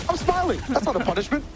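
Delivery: bright excited lift. Pretend. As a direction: voice jumps high on smiling. Caster imagining the player.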